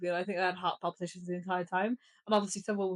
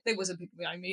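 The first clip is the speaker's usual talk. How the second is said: ordinary talk, nothing marked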